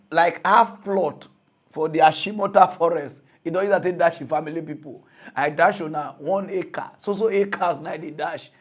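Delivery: ordinary talk, nothing marked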